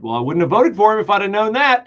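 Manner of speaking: a hint of a whiny voter; mock regretful voter. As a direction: parrot the disappointed voter.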